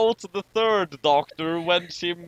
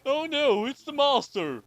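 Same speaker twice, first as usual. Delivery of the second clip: high, hammed-up cry of alarm; a hammed-up gasp of alarm; mock panic; 10 semitones higher than usual. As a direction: cry out in pretend terror, higher. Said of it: "everyone is shouting".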